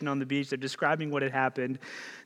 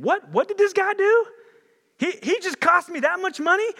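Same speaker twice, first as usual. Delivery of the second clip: much higher and louder outraged yell; furious shout; 8 dB louder than usual. Acted. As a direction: blow up at the loss. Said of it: "ticked off and mad, saying".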